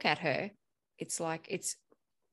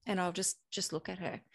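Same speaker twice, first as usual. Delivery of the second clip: ordinary talk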